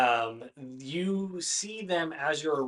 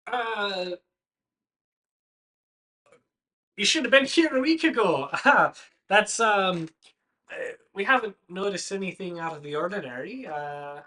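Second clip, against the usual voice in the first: high, loud Irish NPC chatter; a hint of Irish, pitched up; lightly voiced Irish farmer; 5 semitones higher, 7 dB louder than usual. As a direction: keep the Irish farmer voice going. NPC Dinky keeps talking.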